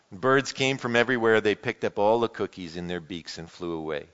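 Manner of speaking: lively read-aloud with voiced characters; animated picture-book reading; storybook reading. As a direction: read it like a children's story to the congregation. Reads Frog and Toad aloud.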